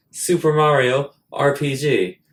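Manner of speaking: normal talking voice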